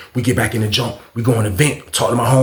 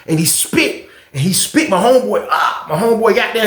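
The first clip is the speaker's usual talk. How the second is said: ordinary talk, nothing marked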